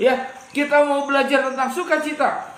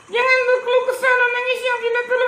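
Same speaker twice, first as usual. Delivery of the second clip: high puppet voice with a mock sob; crying puppet; 11 semitones higher, faster than usual. Puppet repeats the rule, mock crying. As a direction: whine the warning tearfully.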